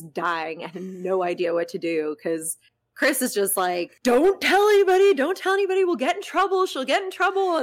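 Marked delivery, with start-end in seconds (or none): 4.0-5.1 high, loud, frantic warning; panicked plea. Retold or imitated. Chris panicking, hiding the incident. 5.1-5.8 panicked repeat. Retold or imitated. Chris panicking, hiding the incident. 5.8-7.6 high, anxious warning; panicked friend. Retold or imitated. Chris panicking, hiding the incident.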